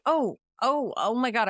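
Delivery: high-pitched mock startled "oh"; feigned surprise. Abby caught in the closet by Tipper, feigning surprise.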